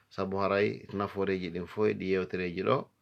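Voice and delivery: usual speaking voice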